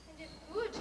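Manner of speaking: normal talking voice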